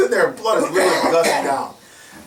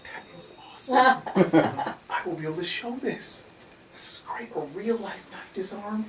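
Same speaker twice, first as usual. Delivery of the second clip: high, whispered giddy delight; 7 semitones higher, 7 dB quieter than usual. Retold or imitated. Officer gloating to himself over his camera, giddy whisper. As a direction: breathe it out high like you can't believe your luck.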